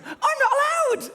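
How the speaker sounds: high, slow, laughing cry; laughing relief. Real, not acted. Remembering he's not allowed, high laughing delight. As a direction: burst out laughing with glee. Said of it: "suddenly remembered".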